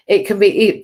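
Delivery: loud, botched first go at the line; a false start, blurted out. Real, not acted. Host fumbling the reading, loud false start. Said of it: "boys, that was wrong".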